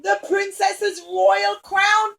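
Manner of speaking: very high, loud alarmed cries, slowed; dismayed crowd. Acted. Everybody cries out over the lost crown, alarmed. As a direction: gasp in horror. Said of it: "And everybody said".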